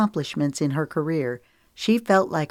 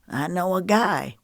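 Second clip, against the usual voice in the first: boastful; 2 semitones higher than usual. A narrator's reading. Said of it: "Ramsey boasted".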